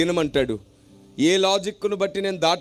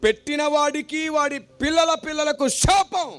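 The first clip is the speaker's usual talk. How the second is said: higher, shouting the curse; shouted curse; 10 semitones higher than usual. Retold or imitated. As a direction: thunder out the curse. Elder pronounces a curse.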